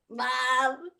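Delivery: put-on gleeful kid voice teasing mom; laughing kids, high and loud; giggling daughters. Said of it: "my girls are laughing".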